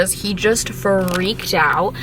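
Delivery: normal talking voice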